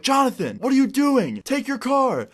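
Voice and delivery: high-pitched acted shout down the street; retold yell. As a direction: call out after someone running off. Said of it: "and I yell, I'm like".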